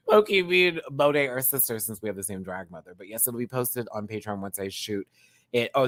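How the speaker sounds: usual speaking voice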